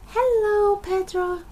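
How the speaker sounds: high, loud call across the clearing. Acted. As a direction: call out to a friend far off. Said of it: "called Peppa".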